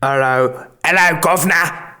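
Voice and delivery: a lightly voiced northern English "Hello, governor"; mild mock Northern English greeting; half-hearted northern English accent; northern British accent. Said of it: "That's more northern than the one before".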